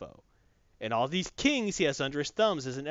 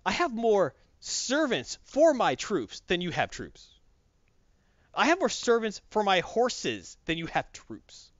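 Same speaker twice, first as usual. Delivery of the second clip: slow, higher mocking boast; taunting emperor; 6 semitones higher than usual. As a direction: draw out each boast to taunt her. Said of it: "when he's taunting her".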